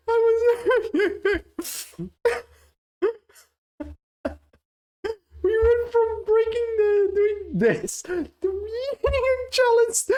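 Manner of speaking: high, breathless laughing through the words; can't stop laughing, squeaky and high; helpless laughter. Real, not acted. Streamer cracking up at the table slam, laughing hard.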